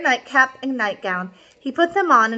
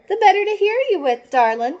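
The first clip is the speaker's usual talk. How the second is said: wolf as grandma; 8 semitones higher, faster than usual. Acted. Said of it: "Señor Lobo said".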